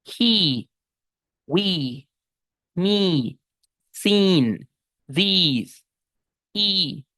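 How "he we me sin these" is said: "he", "we", "me", "these" with a long smiling I; long I words. Shown as a demonstration. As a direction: smile through each "he, we, me". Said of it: "lo vamos a pronunciar como I larga". ("he, we, me" con I larga sonriente)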